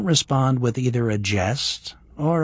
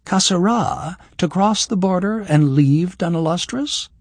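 higher, disbelieving outburst; incredulous exclaim; 9 semitones higher, 4 dB louder than usual. Acted. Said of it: "Kira exclaimed".

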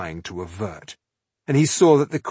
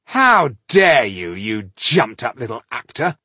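husky indignation; 6 dB louder than usual. A narrator's reading. Indignant count to an actor embracing the queen, husky outrage. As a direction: explode with outrage, leaning on the opening words. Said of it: "his voice husky with indignation".

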